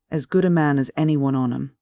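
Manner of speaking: ordinary delivery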